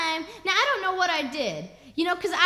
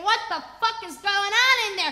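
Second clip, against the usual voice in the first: mildly raised outraged call; imitated neighbor's scream; 3 semitones higher, 4 dB louder than usual. Neighbor demands what's going on, mock screaming. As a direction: call in from next door. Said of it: "the neighbor started screaming".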